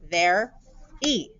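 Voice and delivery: "Ver-e" in two clear beats; syllable split, "Ver-e". Shown as a demonstration. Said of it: "very has two syllables".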